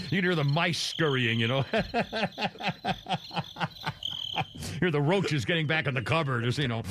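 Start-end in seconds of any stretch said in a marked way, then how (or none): 1.7-4.7 long run of soft cackles; helpless laughter. Real, not acted. Host losing it over the scurrying mice, laughing. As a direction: keep laughing, higher and softer than speech.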